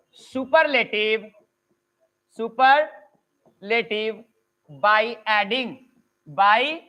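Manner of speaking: slow dictation, words stretched and spaced. Acted. Teacher to online students, slow dictation of the rule. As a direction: spell out the rule slowly for copying.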